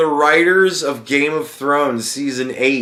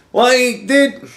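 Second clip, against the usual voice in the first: high, incredulous "dude"; exasperated; 12 semitones higher, 5 dB louder than usual. Real, not acted. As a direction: throw up your hands at the ending. Exasperated at the ending.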